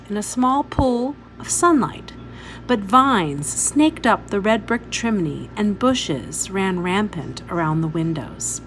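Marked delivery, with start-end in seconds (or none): none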